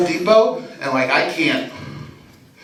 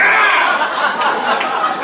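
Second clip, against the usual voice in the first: sudden high-pitched yell; acted scream; 10 semitones higher than usual. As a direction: let out a comic awkward scream. Screams out of car window.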